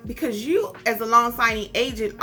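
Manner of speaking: ordinary talk, nothing marked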